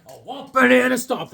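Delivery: ordinary talk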